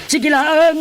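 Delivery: high, loud urging; rising to a shout; pleading shout. Acted. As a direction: push the words out hard and high. Urging a sinner to confess.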